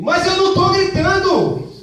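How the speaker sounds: high, loud shout. Acted. Partner insists he is not shouting, while shouting. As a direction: yell that you are not yelling.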